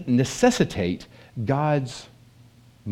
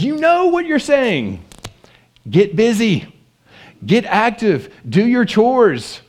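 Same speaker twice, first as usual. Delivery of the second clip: higher, louder, insistent motherly command; mock mother nagging; 9 semitones higher, 8 dB louder, slower than usual. Shown as a demonstration. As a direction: scold like a mom demanding chores. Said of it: "You know what you're saying, mothers".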